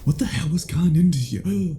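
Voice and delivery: quick, high burst of shock; startled anger. Acted. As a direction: caught off guard by the struggle. Captive fights back suddenly.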